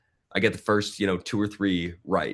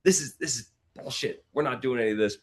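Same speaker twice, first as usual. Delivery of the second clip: imitating wife's firm, higher-pitched refusal; retold indignation, pitched up; pissed-off wife; 5 semitones higher than usual. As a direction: put your foot down as the fiery wife. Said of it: "and she's pissed".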